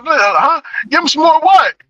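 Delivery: high baffled question; mock confused officer; confused cop. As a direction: play the puzzled partner. Confused officer asks back.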